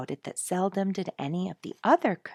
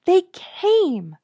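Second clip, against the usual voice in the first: high and loud, the words cried out; a child bursting with the news; shrill happy announcement; excited cry; 13 semitones higher, 10 dB louder than usual. A narrator's reading. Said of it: "Sonia cried".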